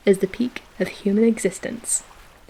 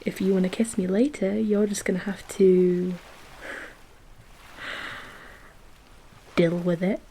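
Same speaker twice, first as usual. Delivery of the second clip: soft teasing half-whisper dropping to a whisper; teasing hush; 8 dB quieter than usual. Real, not acted. Flirting with her partner about pickle breath, soft and teasing. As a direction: lean in and tease them quietly.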